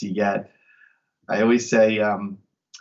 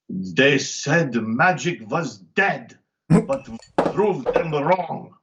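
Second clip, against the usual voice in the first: slow, higher imitation of a thick German accent; 4 semitones higher, much slower than usual. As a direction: mimic Siegfried's heavy German accent, drawn out. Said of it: "in his thick German accent".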